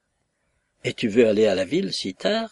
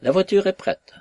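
higher, louder call out; servant's call; 8 semitones higher, 5 dB louder than usual. A narrator's reading. Footman calls out to the household, loud and high. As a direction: announce it across the room. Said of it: "cria un laquais".

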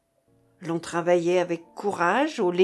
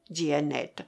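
plain reading voice

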